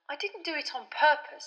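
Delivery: usual speaking voice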